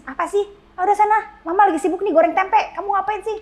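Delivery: snappy annoyed parent voice; irritated mother. Retold or imitated. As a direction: brush the crying kid off while frying tempeh. Impatient busy mother brushing off child.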